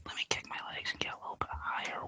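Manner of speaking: fast breathless whisper. Pretend. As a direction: whisper it quickly to yourself. Whispered aside on the swings.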